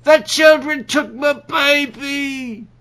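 high, loud, slow wailing cry; anguished wail. Acted. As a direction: wail it like a heartbroken parent.

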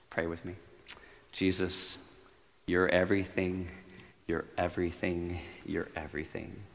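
soft, fading prayer voice. Acted. Preacher leads the congregation in prayer, soft and earnest. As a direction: pray quietly over the room.

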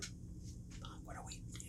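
barely audible whisper; panicky mock whisper. Kid hiding from the driver, urgent acted whisper. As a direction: whisper so the driver won't hear. Hidden kids conferring.

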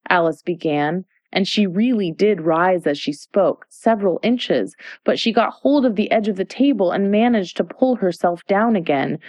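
usual speaking voice

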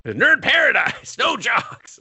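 high, drawn-out mock chant; mock crowd shout. Nerds rejecting sports, high mock shout. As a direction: holler it high like a rallying crowd.

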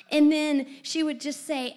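ordinary talk

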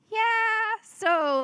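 high, drawn-out, reluctant yes; sheepish admission. Retold or imitated. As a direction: stretch it high, easing into the request.

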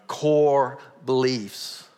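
core beliefs drawn out slow and loud; preaching emphasis on "core beliefs". Real, not acted. Preacher stressing key phrase.

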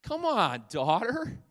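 high, hammed-up whining dad; comically wheedling father, voice pushed up high; pleading dad. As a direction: plead with your daughter for one little fry. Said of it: "To which the dad says".

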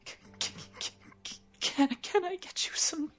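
high, stuttering, trembling offer; shaky stammer. Acted. Shaken mother offers the guests tea, stammering. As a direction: stumble over every word, hands shaking. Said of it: "Her hands are shaking".

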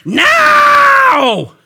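huge high-pitched drawn-out shout; old man's yell. Retold or imitated. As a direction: yell it right in their face, long and high. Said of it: "he yells right in my face".

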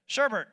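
Sherbet said "SHER-bert"; wrong pronunciation. Shown as a demonstration. Preacher showing the common mistake, Sherbet as "SHER-bert". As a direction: add an R to the end of Sherbet. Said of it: "it's not sherbet".

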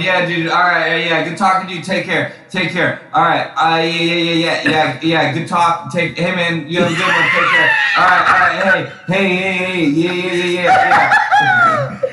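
high, hurried string of goodbyes; impatient phone goodbye. Retold or imitated. Himself trying to end a call with Adam Chappell, impatient. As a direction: rattle off endless take cares to escape. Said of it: "that's me talking to Adam Chappell on the phone".

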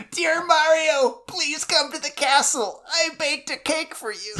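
high-pitched mock princess voice; Princess Mokuba. Co-host as a princess writing to Mario, high put-on voice. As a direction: read the letter like a video game princess, very high. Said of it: "Princess Mokuba".

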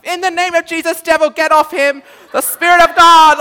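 high, very loud, commanding the devil out; loud fervent prayer. Retold or imitated. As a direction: bellow the prayer like a street preacher casting out a demon. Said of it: "like this".

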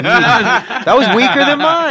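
high, loud laughing tease. Real, not acted. Singer laughs that the drummer's squeal was weaker. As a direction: laugh it at your bandmate.

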